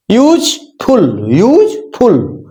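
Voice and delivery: यूजफुल said very slowly as यूज and फुल; syllable by syllable. Shown as a demonstration. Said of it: "यूज हो गया एक, दूसरा फुल हो गया". (यूजफुल को यूज-फुल अलग करके)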